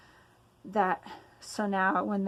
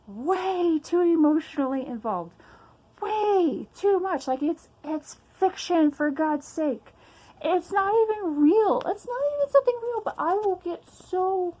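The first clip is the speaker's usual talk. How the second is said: exasperated rant; 6 semitones higher, 4 dB louder than usual. Real, not acted. Venting frustration, voice rising.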